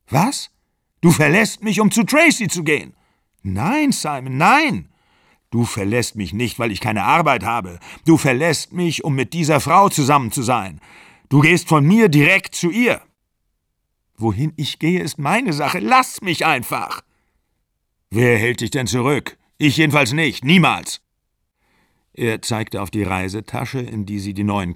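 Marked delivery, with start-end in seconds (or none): 0.1-13.0 higher and louder, raging; shrill furious shouting. A narrator's reading. Shouted quarrel about Tracy. 14.2-21.0 angry retort. A narrator's reading. Both shout at the door.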